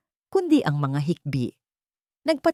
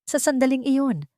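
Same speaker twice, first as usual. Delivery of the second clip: usual speaking voice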